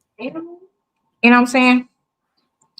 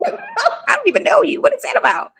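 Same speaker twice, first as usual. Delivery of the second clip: incredulous squeal. Retold or imitated. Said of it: "And I'm sitting here like".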